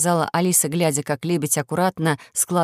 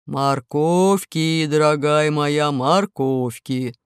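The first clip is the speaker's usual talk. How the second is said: wolf voice; 3 semitones lower, much slower than usual. Acted. Wolf pleads.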